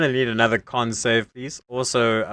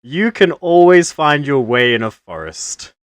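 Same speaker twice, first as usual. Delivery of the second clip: loud druid blessing; 7 dB louder than usual. Acted.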